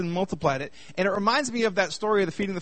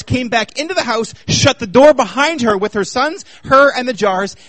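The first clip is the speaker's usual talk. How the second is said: louder, higher preaching voice; full-force preaching; 3 semitones higher, 6 dB louder than usual. Acted. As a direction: drive the point home to the congregation.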